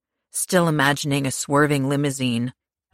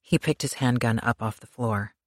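ordinary delivery, nothing marked

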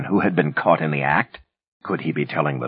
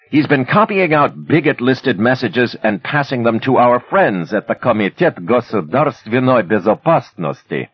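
louder, higher yell over the noise; yelled over furnace; 7 semitones higher, 4 dB louder than usual. A narrator's reading. Manny yells to Inkerman over the furnace noise. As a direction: shout over the roaring furnace. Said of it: "Manny yelled over the furnace".

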